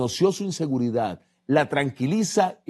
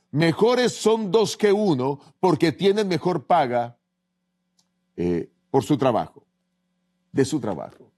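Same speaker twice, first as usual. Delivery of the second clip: scripture reading; 2 semitones higher than usual. Acted. Reads Eclesiastés 4:9 aloud.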